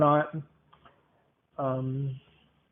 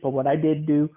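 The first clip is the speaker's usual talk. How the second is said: usual speaking voice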